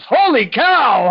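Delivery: high, drawn-out exclamation of shock; hammed-up, stretched astonishment; astonished. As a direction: imitate a doctor stunned by the results. Retelling doctor's shock at height check.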